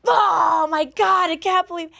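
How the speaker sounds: high, loud, slow stunned gasp; mock astonishment. Brother finally seeing the ketchup on the table, mock astonished. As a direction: stretch out the shock of discovery.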